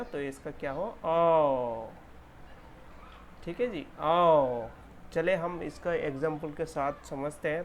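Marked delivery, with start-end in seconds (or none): 1.0-4.7 ओ stretched out louder. Shown as a demonstration. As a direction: lengthen the ओ. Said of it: "stretch कर दिया".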